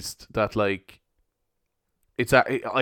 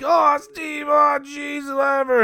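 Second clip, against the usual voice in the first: high shouted groan of disgust; playful schoolboy protest, much higher and louder; the whole class yelling at Steve in mock outrage; mock class outcry; 3 dB louder, slower than usual. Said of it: "and we were all like".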